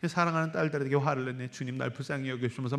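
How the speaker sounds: soft, sighing self-reproach; mild mock of the wife sighing at the sink; quiet lament. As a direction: murmur it to yourself over the dishes. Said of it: "속상해서 이제 설거지를 하면서".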